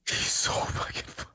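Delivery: breathless whisper of admiration; whispered gush. Real, not acted. Fan gushing about Laszlo to friend, whispered. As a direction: hush it, overwhelmed.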